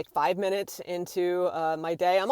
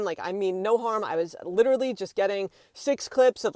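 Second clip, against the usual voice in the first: usual speaking voice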